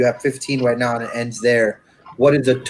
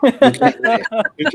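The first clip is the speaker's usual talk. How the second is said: teasing singsong; 11 semitones higher, 3 dB louder, slower than usual. Acted. Teasing a co-host.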